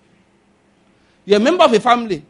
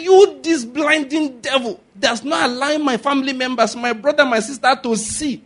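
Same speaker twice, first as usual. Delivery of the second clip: raised, higher rebuke building to a shout; commanding prayer; 6 semitones higher, 4 dB louder than usual. Shown as a demonstration. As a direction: command the devil out, rising to a shout.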